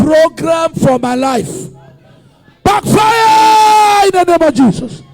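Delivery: loud, chanted repeated decree; shouted prayer. Acted. Preacher leading the church against shame, loud chanting prayer. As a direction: drive each backfire home.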